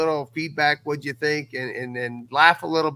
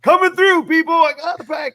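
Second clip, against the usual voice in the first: much higher, loud goofy cartoonish shout; Ace Ventura voice; 9 dB louder than usual. Podcast host reenacting the Ace Ventura package scene, high loud goofy voice. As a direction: ham it up like a slapstick delivery guy barging in. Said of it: "I'll be like".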